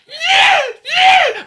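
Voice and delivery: piercing, very high yelps of pain; two loud falsetto howls, hammed-up; mock agony shriek. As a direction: re-enact screaming in agony. Acts out injured groin agony.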